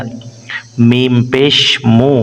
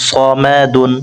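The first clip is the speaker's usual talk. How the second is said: ordinary delivery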